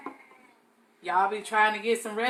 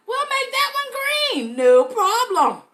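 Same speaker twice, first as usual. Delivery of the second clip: sing-song reassurance; 8 dB louder, slower than usual. Real, not acted. Brushing off a mistake.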